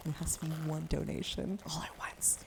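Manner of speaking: soft whispered agreement; quiet ASMR aside. Real, not acted. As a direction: whisper it to the mic.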